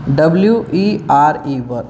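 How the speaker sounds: slow letter-by-letter spelling of W E R E, then वर said clearly; spelling out the word. Shown as a demonstration. Teacher spelling a word out slowly for students. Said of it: "उन्हीं के साथ वर लगेगा".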